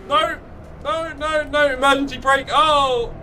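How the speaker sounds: high, panicked no no no as the car ahead stops; alarmed cries. Real, not acted. Streamer to viewers, caught by a crash ahead: high panicked cries. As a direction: slam the brakes in real alarm, voice jumping up. Sudden braking in a race.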